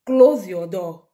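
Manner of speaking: quoting mother's order. Retold or imitated. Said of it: "Here she said".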